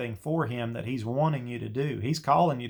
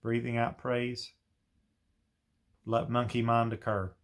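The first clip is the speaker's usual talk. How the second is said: guided meditation; 2 semitones lower, 4 dB quieter than usual. Shown as a demonstration. Teacher demonstrating meditation to prophets, soft and unhurried. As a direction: ease each phrase out gently like a meditation leader. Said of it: "You don't have to say it like I just did".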